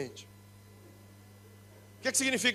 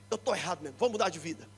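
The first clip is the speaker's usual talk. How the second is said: quiet self-admission; 7 semitones lower, 10 dB quieter, faster than usual. Retold or imitated. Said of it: "pensa e fala assim".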